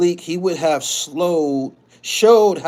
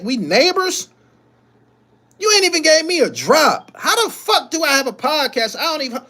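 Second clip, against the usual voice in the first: aggrieved friend; 10 semitones higher than usual. Acted. Voicing [name] complaining to [name].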